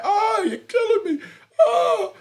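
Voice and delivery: high, loud hammed-up moan; mock moaning. Retelling his own cries in bed, hammed-up loud moan. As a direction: overplay a lover's moan. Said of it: "I was like".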